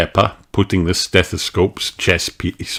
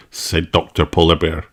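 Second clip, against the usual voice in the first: ordinary delivery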